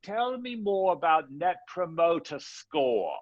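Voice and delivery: high, squeaky mock Cockney; slow, high-pitched hammed-up Cockney caller; Cockney accent. Caller asking George about business, high comic Cockney accent. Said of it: "in a Cockney accent".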